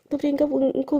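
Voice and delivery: ordinary delivery, nothing marked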